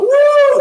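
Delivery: high, loud "woo" of a fun lover; party whoop. Acted. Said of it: "you're just a crazy blue fun person".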